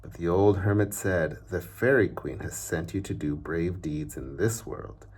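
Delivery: plain reading voice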